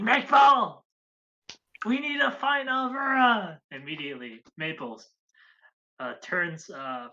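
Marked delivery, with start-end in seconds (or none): 0.0-3.6 high, loud strained yell; a hushed scream pushed to a shout; whisper screaming. Retold or imitated. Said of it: "starts whisper screaming".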